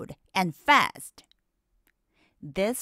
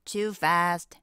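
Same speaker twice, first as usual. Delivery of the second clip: usual speaking voice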